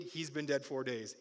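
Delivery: normal talking voice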